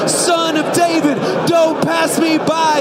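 high, slow, drawn-out shout; last desperate bellow, each word stretched; all-out cry. Acted. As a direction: cry out with everything in you. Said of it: "cry out with everything in you".

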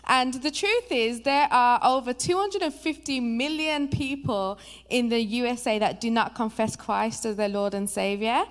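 ordinary talk, nothing marked

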